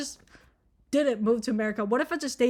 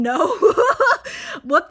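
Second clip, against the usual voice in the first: laughing; 7 semitones higher, 8 dB louder than usual. Real, not acted. Laughs at own story.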